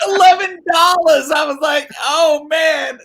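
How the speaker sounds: high, loud disbelieving cry; amazed. Retold or imitated. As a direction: repeat it in delighted astonishment. Amazed repeat of the low price.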